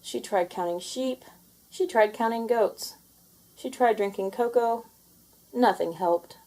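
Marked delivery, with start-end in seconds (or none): none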